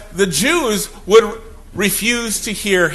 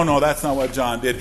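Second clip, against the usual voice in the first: ordinary talk, nothing marked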